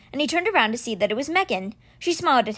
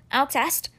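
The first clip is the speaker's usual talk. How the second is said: ordinary talk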